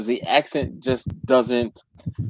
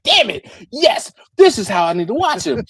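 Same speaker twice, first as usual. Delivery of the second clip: higher and louder, delighted discovery; excited realization; 8 semitones higher, 5 dB louder than usual. Retold or imitated. Recalling the joy of sped-up videos, excited. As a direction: blurt it out like a eureka. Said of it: "And I was like".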